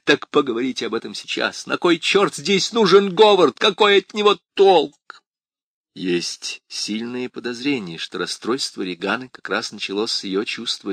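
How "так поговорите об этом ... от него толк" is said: громкий высокий крик; кричи всё сильнее; frantic shouting. A narrator's reading. Крис в истерике кричит. (надрывный крик)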